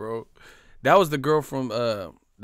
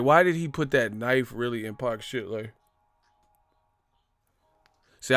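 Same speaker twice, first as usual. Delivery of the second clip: usual speaking voice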